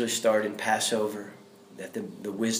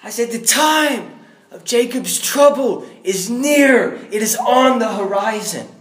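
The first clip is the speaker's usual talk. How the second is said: prophetic proclamation; 12 semitones higher, 9 dB louder than usual. Retold or imitated. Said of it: "the Holy Spirit, like, filled me".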